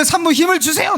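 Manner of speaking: high-pitched mimicked delivery-room urging; mock yell. Medical staff urging a mother in labor to push, mock yell. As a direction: imitate an urgent call without real volume. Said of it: "막 하면서 막 소리 지르고".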